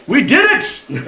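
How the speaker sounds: high thin reedy cry of triumph. Acted. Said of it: "the reedy voice of Adeptus Draconis saying".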